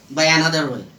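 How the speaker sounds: usual speaking voice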